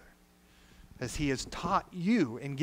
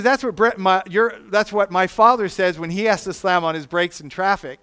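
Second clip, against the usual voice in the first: lightly voiced excited little girl, louder and higher; little niece; 3 semitones higher, 10 dB louder than usual. Niece explaining where she heard the word, mock childlike and loud. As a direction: blurt it out innocently like a small child.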